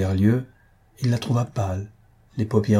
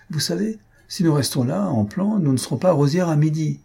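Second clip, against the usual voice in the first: pitched higher, a sudden eager outburst; sudden exclamation; 7 semitones higher than usual. A narrator's reading. D'Étiole suddenly urging the group to leave, higher exclamation. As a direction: break in suddenly, voice lifted high. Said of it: "s'écria tout à coup".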